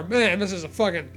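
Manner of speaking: ordinary talk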